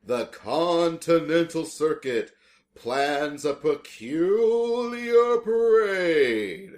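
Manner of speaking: slow, high, loud spooky drawl. Pretend. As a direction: intone it like a haunted ringmaster. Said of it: "I really like making this spooky voice".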